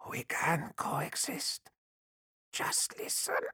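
slow pleading alien whisper; dry whisper. Acted. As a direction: whisper the plea slowly. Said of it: "in the dry whisper of the Tau voice".

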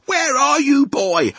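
high, loud father's demand; baffled daddy's holler; shouting. A narrator's reading. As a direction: shout out for a missing boy. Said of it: "and he shouts".

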